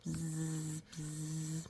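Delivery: soft buzzing; soft imitated mosquito buzz.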